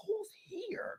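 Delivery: high, breathless whisper; security guard hushing a question. Retold or imitated. Security guy asking who is coming, whispered.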